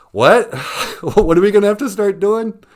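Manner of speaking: high, loud startled disbelief; panicked social workers. Retold or imitated. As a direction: squawk it in alarm. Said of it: "They're like".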